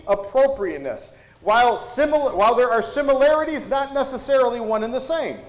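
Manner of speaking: ordinary talk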